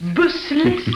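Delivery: ordinary talk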